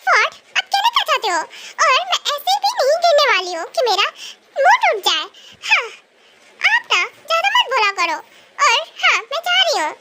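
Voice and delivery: put-on a girl brushing off the teasing; indignant young girl. Indignant girl shooting back at the teasing teacher.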